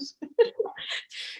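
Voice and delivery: a high giggle, nearly breathless; high unvoiced giggle; high squeaky laugh. Real, not acted. Laughs at own story.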